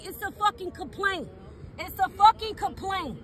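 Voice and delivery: normal talking voice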